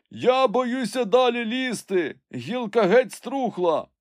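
slow, high, drawn-out cry; frightened call. A narrator's reading. Climber fears the rotten branch, high anxious call. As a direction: call down in fear. Jupiter afraid, calls from the tree.

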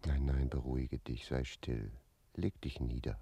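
calm her without a sound; soothing whisper. Acted. Jakob hushes the trembling girl, soft whisper. Calming her, must stay silent.

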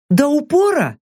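a curious mercenary woman asking; Saka asking. A narrator's reading. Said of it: "поинтересовалась входящая в центральный ствол Сака". (высокий женский голос, с вопросом)

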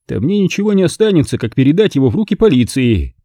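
a confident host relishing his plan; Stanton's voice. A narrator's reading.

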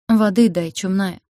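ordinary delivery, nothing marked

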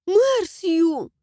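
put-on high, alarmed girl voice; frightened. A narrator's reading. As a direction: cry out in fright. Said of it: "В её голосе послышался страх".

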